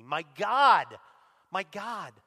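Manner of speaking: sudden loud cry, easing on the repeat; anguished loud cry. Acted. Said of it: "cried out in a loud voice".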